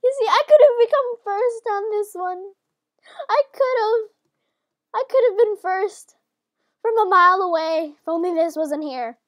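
high, loud protest; loud, indignant complaining in bursts; frustrated outburst. Real, not acted. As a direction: yell the grievance at the game. Frustrated at losing first place.

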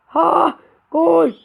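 high, loud surprised shout; surprised goal cry. Real, not acted. Gamer scoring unexpectedly, surprised shout. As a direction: burst out in disbelief.

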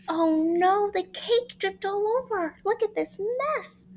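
high upset monkey voice; dismayed. Acted. Monkey seeing the ruined cake, high dismayed cry. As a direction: cry out at the mess.

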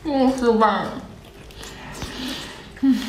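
muffled by food in mouth; humming while chewing; mouth full, chewing. Real, not acted. Seen 0: Mouth stuffed with enoki-like strands, chewing while murmuring.

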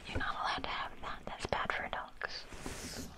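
ordinary delivery

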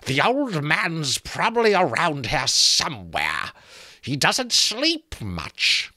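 high, slow creaking taunt; creaking skull voice. A narrator's reading. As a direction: creak and knock like branches in wind. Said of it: "sounded like branches creaking".

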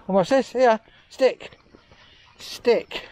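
high sing-song voice for a dog; high pet voice. Real, not acted. Talking to the dog.

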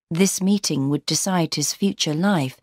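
plain reading voice

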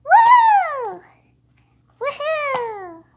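high, loud woo; excited cheer. Pretend. Toy whoops with joy playing at the park. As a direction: let out a big happy whoop.

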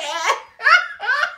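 high laugh. Real, not acted. Laughs at own imitation.

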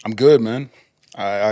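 ordinary talk

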